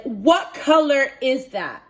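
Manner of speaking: loud, slow, incredulous question; alarmed demand. Retold or imitated. Client grabs hairdresser's hand, alarmed demand. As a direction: demand an answer, stretching each word.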